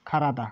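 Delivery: খারাদা starting "kha", not "ka"; exceptional pronunciation. Shown as a demonstration. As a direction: model খারাদা as kha-ra-da, first sound aspirated. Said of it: "এটি হয়ে যাবে খারাদা".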